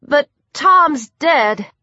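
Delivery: reluctant, drawn-out regret; helpless. A narrator's reading. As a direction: say it slowly and helplessly. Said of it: "Luke said helplessly".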